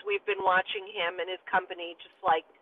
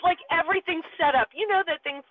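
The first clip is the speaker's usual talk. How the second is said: ordinary delivery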